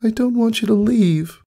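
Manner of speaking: put-on young filly voice, high and choked up. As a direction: hold back tears as you say it. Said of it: "Her voice came out choked".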